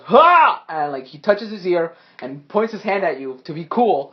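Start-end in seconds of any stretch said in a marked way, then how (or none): 0.1-0.6 loud, high single burst; sudden showy battle cry; acted "ha". Game master voices the foe striking a pose, loud cry. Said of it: "he goes".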